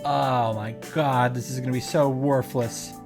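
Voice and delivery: ordinary talk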